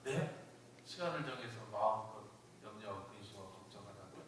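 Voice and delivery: low, hushed echo of the command; ungläubige Rückfrage. Acted. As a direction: ask it back softly, dropping almost to nothing. Echoes God's command back in disbelief.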